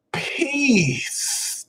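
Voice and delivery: high airy sign-off impression; imitating his half brother. Guru imitating his half brother's video sign-off, high and airy. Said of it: "he used to start saying it in his videos".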